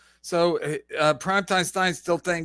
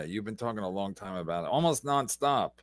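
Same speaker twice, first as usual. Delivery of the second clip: soft, lower, quick dig; quiet fast aside; 11 semitones lower, 8 dB quieter than usual. Real, not acted. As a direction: mutter it as a side jab. Teasing guest who talks long.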